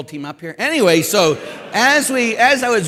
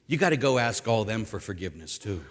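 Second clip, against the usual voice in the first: lightly voiced, lower and softer; a quieter, low-pitched aside as Jesus; Jesus's gentle reply; 8 semitones lower, 7 dB quieter than usual. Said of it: "But then he'll say".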